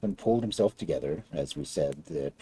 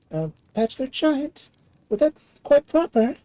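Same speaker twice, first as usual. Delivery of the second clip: much higher, surprised princess; light, humoring young lady; 13 semitones higher, 5 dB louder than usual. Acted.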